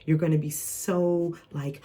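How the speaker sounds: ordinary talk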